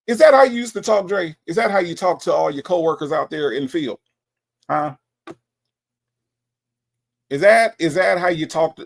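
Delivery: loud, high opening, then settling; mock indignation. Host asking Dre about coworkers in the field, mock indignant. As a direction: burst in with rapid accusing questions.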